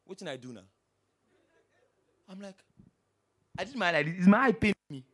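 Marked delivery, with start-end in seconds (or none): 0.1-0.7 sulky low mumble of the careless driver; Pidgin guy. Retold or imitated. Driver to the crowd, a low sulky mumble. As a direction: play the driver shrugging it off. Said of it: "The guy now come and say".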